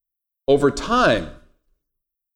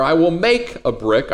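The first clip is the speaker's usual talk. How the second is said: "make" raised and stretched out; stress on MAKE; 2 semitones higher, slower than usual. Shown as a demonstration. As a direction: lean hard on the word "make". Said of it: "where you put the emphasis".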